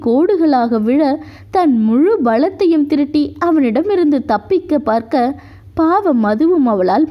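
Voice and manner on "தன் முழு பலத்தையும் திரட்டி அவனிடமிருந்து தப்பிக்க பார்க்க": usual speaking voice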